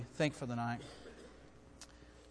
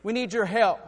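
loud, higher desperate plea; urgent plea; 7 semitones higher, 10 dB louder than usual. Acted. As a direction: beg for help through the door. Neighbor begging for help.

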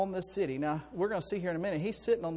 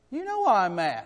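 louder retort, mildly mock-angry; mild irritation; 3 semitones higher, 6 dB louder than usual. Child answering mom's question, mildly irritated. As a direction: answer back with a touch of temper. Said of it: "when I got mad".

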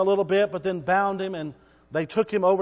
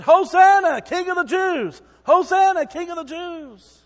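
much higher, loud cries of praise; preacher voicing the crowd hailing their king, loud and high; jubilant crowd; 10 semitones higher, 8 dB louder than usual. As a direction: cry it out like a cheering throng. Crowd acclaiming Jesus earlier.

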